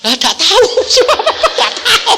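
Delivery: high, loud giggling quote; laughing retelling. Retold or imitated. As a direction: laugh through the daughter's reply. Daughter's answer retold, laughing.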